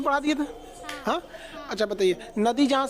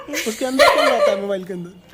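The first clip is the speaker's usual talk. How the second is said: laughing; 3 semitones lower, 6 dB louder than usual. Real, not acted. Teasing child about phone teacher.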